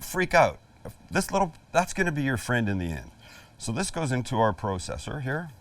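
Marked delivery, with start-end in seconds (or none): 4.5-5.2 a hint of a Canadian accent. Shown as a demonstration. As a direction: say it with a lightly put-on Canadian accent. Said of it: "said that in my best Canadian accent".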